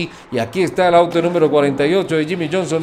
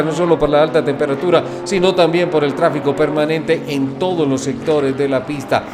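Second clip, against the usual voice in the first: normal talking voice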